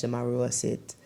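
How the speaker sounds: ordinary talk, nothing marked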